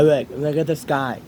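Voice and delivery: ordinary talk, nothing marked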